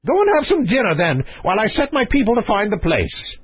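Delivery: high-pitched royal dismissal; brusque king. Acted. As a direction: order him off as the excitable king.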